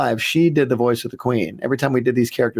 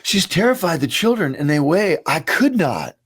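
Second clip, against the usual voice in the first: higher, theatrical echo of a cartoon character; imitated alien read; 4 semitones higher than usual. Repeating a favorite read of terrified children, high character voice. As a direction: mimic the grand line reading, pitched up. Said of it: "Just that read right there".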